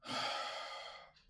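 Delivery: long breathy exhale; heavy sigh. Acted. Weary pause in war story.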